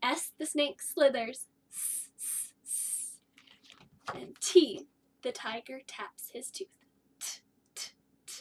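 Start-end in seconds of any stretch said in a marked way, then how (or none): 1.7-3.2 long unvoiced S hiss; snake hiss sound. Shown as a demonstration. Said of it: "letter sound review". 7.2-8.3 short unvoiced T clicks; crisp T taps. Shown as a demonstration. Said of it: "letter sound review".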